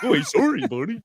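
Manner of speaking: jokey Canadian apology; mock Canadian accent. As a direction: tease with a comic Canadian accent. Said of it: "That's Canadian".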